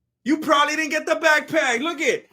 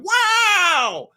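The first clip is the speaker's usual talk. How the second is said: high, drawn-out unimpressed wow; a condescending surfer-dude wow; Owen Wilson impression; 7 semitones higher, 3 dB louder than usual. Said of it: "He went full Owen Wilson".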